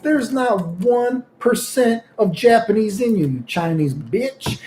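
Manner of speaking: slow, drawn-out put-down; friend's slow mocking retort. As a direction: drag each word out as an insult. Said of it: "my friend was like".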